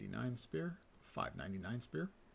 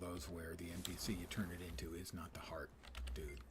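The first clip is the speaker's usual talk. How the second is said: whispering; 6 semitones lower, 8 dB quieter than usual. Real, not acted. Muttered aside while playing.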